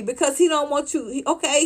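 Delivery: ordinary delivery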